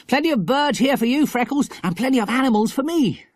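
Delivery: high, eager schoolboy voice; excited boy. A narrator's reading. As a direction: exclaim happily to a friend. Philip excited by the country.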